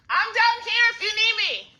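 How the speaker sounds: loud high call across the house. Real, not acted. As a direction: call out to someone downstairs. Calls out to someone downstairs.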